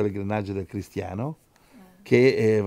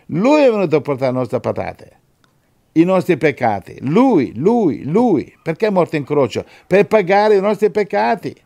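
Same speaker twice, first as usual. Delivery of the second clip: loud, emphatic gospel proclamation; impassioned preacher driving it home; preaching at full force; 6 semitones higher, 7 dB louder than usual. Real, not acted. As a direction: hammer each phrase out, high and loud.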